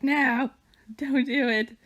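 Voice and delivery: mock alarmed cry. Cat stepping on keyboard.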